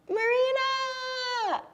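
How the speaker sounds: very high-pitched, drawn-out squeal of the name; excited squealed greeting. Real, not acted. Delighted greeting to a viewer joining.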